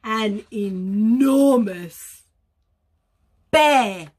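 loud, stretched-out ENORMOUS; slow, booming announcement for children; dramatic reveal. A narrator's reading. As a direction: swell into a big storybook reveal. Big reveal of the bear.

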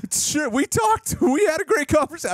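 very high-pitched send-up; mock glib claim. Host imagines director bluffing about a talk, high mock claim. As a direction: shoot the voice way up in mock assurance. Imagines Gunn inventing a conversation.

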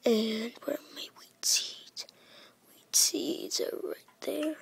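breathy voiceless whisper, rising at the end; whispering to himself while looking for seeds. Real, not acted. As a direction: hush it while searching. Whispering while searching inventory.

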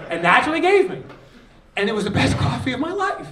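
high, loud laughing telling. Real, not acted. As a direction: laughs through the punchline. Laughing at memory.

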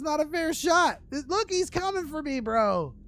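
loud, high frustrated yelling. Real, not acted. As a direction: shout it at the screen. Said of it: "Sorry for yelling so loud".

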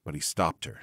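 plain reading voice

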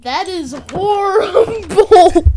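high, loud, slow laughing cry; laughing shout. Real, not acted. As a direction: shriek it through laughter. Reacting to voice changer.

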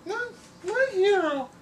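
very high, sweet falsetto damsel; swooning cartoon lady. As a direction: gush like a rescued heroine. Drawing now a lady character.

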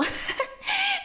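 squealing high laugh; high burst of laughter. Acted. Colleague laughing at the Halloween costume idea.

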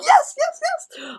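put-on overjoyed kid squeal; gleeful child's cry of yes; excited yes. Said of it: "I was getting so excited".

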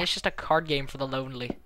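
ordinary talk, nothing marked

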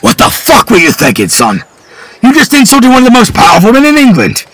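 high, loud furious rant; father raging at his son; furious scolding. Acted. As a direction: berate the son. Father berates son outside.

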